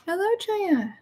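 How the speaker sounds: high sing-song cat voice. Real, not acted. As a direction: coo to the cat. Greeting the cat.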